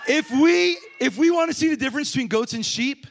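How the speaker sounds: loud, high urgent call; preaching shout. Real, not acted. Preacher urging the congregation, loud high shout. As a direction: drive the point home to them.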